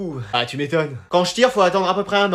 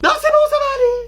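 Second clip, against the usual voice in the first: high refusal. Acted. Declining the boxing demo.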